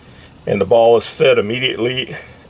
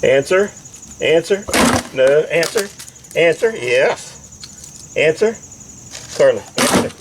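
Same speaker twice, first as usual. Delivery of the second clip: dog command voice; 5 semitones higher than usual. Real, not acted. Commanding dog to press button.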